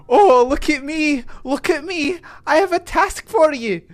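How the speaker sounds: high, loud, hammed-up Scottish accent. Streamer voices a pixie quest giver in a Scottish accent. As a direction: put on an embarrassed attempt at a Scottish accent. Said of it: "I can do a Scottish accent".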